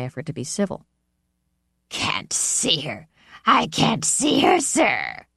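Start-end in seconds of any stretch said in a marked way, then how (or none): 1.9-5.3 high mocking mimic of the boy; mocking mimicry. A narrator's reading. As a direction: mimic him with scorn. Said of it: "Olga mimicked".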